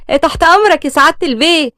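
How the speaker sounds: high, dutiful maid voice; obliging maid. A narrator's reading. Maid answers the master's call.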